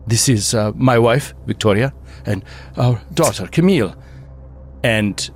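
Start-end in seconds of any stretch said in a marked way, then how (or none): 0.1-3.9 put-on hesitant, courteous accented host; lightly voiced foreign gentleman introducing his family; polite introductions with careful pauses; undead host. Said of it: "He's like".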